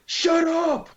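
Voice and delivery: very high, loud yell; pranking workers on the radio, high loud yell; mock shout. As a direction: snap it out as a sudden shout. Yelling on the walkie-talkie.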